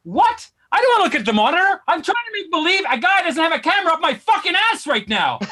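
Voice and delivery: high-pitched, quickening indignant protest; incredulous outrage. Acted. Patient refusing the doctor's monitor offer, incredulous outrage. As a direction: start on a shocked high shout, then protest.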